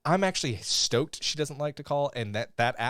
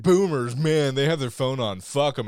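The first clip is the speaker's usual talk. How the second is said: loud, dismissive mock grumbling; mocking impression of a loud complainer; mock rant; 3 semitones higher, 8 dB louder than usual. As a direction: rant it loudly, poking fun at a friend. Mimics co-host ranting at boomers.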